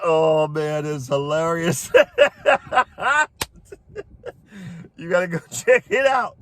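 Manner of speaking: can't stop laughing while telling it. Real, not acted. Laughing at the video.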